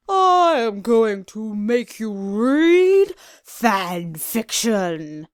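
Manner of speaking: slow, looming villain announcement; menacing villain. Acted. Captor taunting his prisoners, slow and menacing. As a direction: drag it out like a gloating captor.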